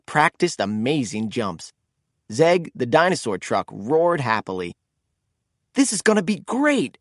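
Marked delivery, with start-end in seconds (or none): 5.7-7.0 bright excited call, pitched up; thrilled at the coming race; excited exclaim. A narrator's reading. AJ to his friends, excitedly. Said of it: "exclaimed A J".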